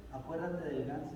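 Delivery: hushed, sly blackmail; quiet threat. A narrator's reading. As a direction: murmur it low like a secret threat. Sister threatens brother in secret.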